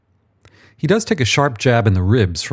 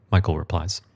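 ordinary delivery, nothing marked

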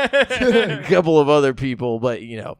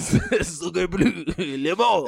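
laughing fake French accent; hammed-up French chef, giggling; 3 semitones higher, much slower than usual. Echoes French joke.

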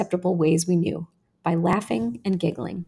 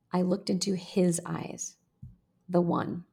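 ordinary delivery

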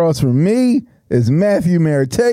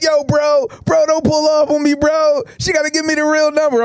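higher, pleading "bro, bro"; desperate, holding onto the car; desperate guy; 8 semitones higher than usual. Retold or imitated. Guy holding the car begs the driver not to pull off, higher and desperate. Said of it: "He's like".

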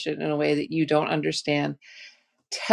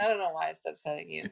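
sarcastic; 9 dB quieter, faster than usual. Shown as a demonstration. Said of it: "you're putting Lisa spin on it".

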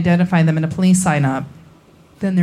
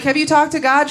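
pitched up, mock concerned relatives; raised, mildly alarmed question; high incredulous family question; 7 semitones higher than usual. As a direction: ask it high and baffled. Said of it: "We're like".

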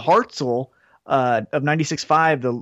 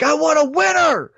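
higher mock yell, barely louder; retold yell; 12 semitones higher, 3 dB louder than usual. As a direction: mimic a fan yelling in the stands. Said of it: "I yelled that really loud".

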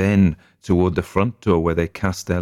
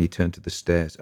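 usual speaking voice

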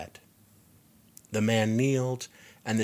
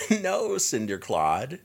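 delighted squeal; 6 semitones higher, 4 dB louder, slower than usual. A narrator's reading. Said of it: "Coletta squealed in delight".

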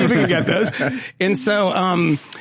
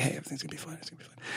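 put-on soft, hushed words to calm a child; hushed reassurance; 2 semitones lower, 19 dB quieter, much faster than usual. Father hushing his crying daughter in the car, softly reassuring. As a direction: quietly reassure a crying kid. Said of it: "I'm like".

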